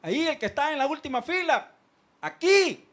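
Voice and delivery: shush, then call out sharply to a distracted crowd; loud, high summons; calling for attention. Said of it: "A veces le decimos".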